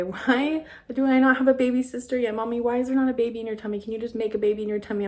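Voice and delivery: rushed mock begging of a little girl; begging child. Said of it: "Mila is just begging every day".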